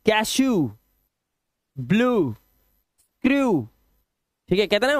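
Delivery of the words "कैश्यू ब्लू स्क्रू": कैश्यू, ब्लू, स्क्रू each held on "oo"; long "oo" endings. Shown as a demonstration. Teacher reading examples to learners, long "oo" ending कैश्यू, ब्लू, स्क्रू. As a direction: draw out the final "oo" of ब्लू and स्क्रू. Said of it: "ऊ की आवाज".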